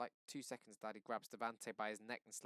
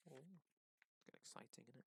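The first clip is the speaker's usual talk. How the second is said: hushed; 2 semitones higher, 16 dB quieter, faster than usual. A narrator's reading. Narrator's hushed aside.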